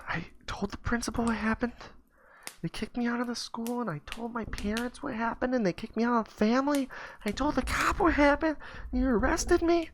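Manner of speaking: put-on very high, hushed child's voice, mostly whispered; little kid voice. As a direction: tell it meekly like a scared five-year-old. Boy explains why he was arrested.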